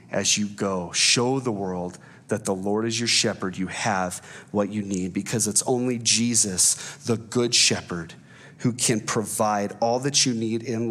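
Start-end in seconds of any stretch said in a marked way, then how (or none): none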